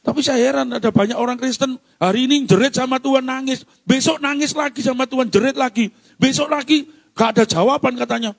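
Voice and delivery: high, urgent exhortation; forceful preaching. Real, not acted. Preacher urging the congregation to turn to God, forceful preaching. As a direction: press it home from the pulpit.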